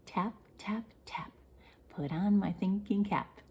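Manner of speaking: whispered chant, no voice at all. Shown as a demonstration. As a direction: breathe the line in a secret whisper.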